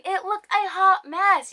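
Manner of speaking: high, slow exasperated verdict; indignant. Real, not acted. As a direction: declare it in drawn-out dismay. Indignant about the wig.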